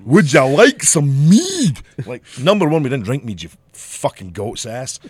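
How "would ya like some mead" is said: loud, slow, hammed-up Scottish accent; high, drawn-out theme-restaurant Scots; mock Scottish greeting. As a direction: bellow the offer like a fake Scottish tavern host.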